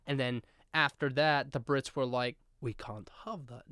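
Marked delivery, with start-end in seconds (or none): 2.6-3.6 quiet, low attempt at a British accent; a weak stab at a posh British accent; bad British accent. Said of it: "I can't do a British accent".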